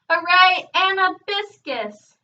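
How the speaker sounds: high, loud cheer with the name drawn out; shouted cheer. A narrator's reading. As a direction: cheer for the singer at full voice. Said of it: "shouted Trouble".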